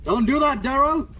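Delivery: high, loud command; urgent shout. Acted. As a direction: yell to stop him. Hero tries to stop the killer.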